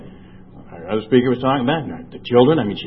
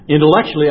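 Intellectually said louder, higher, punched; stressed; 5 semitones higher, 6 dB louder, faster than usual. Real, not acted. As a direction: lean hard on Intellectually. Repeated word for emphasis.

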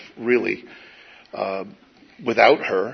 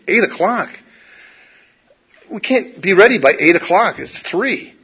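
higher, disbelieving "Eight o'clock?" rising; retelling his shock that the car comes at eight o'clock; incredulous; 6 semitones higher than usual. Retold or imitated. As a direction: balk at how soon that is. Shocked at the early pickup time.

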